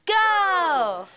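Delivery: shouted cheer, leading the crowd; cheer call. Acted. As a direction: shout it to lead the room's cheer. Leading a call-and-response school cheer.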